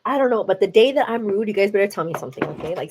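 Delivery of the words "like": normal talking voice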